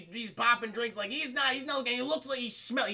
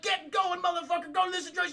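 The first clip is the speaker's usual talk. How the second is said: much higher put-on tough-guy voice; threatening thug; 8 semitones higher than usual. Darby ordering the cop to drive, high mock threat.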